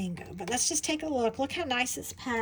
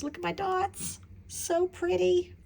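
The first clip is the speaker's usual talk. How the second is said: delighted; 7 semitones higher than usual. Real, not acted. Admiring tiles.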